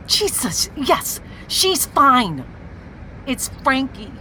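normal talking voice

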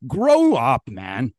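angry scolding. Real, not acted. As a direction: snap at him to grow up. Scolding outburst.

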